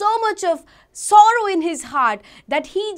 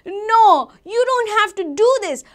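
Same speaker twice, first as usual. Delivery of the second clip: raised, high-pitched plea; bold; 7 semitones higher, 6 dB louder than usual. Acted. Boy standing before the gunman, bold and loud. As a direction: face him down boldly and loudly. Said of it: "stood boldly and faced him and said".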